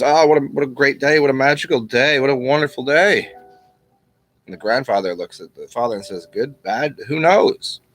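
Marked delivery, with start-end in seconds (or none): 0.0-3.3 higher-pitched, acted-out rejoicing; overjoyed father. Father rejoices in retold parable. 6.3-7.8 unhurried, lilting up on "who knows"; noncommittal grandfather. Acted. Grandfather's refrain in retold parable.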